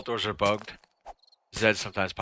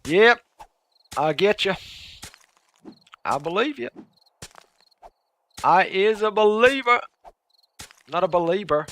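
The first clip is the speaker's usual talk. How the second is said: high, loud goofy character voice; goofy high put-on voice; silly put-on voice; 10 semitones higher, 7 dB louder than usual. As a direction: ham it up in a silly squeaky voice. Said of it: "I don't know why I'm talking like this".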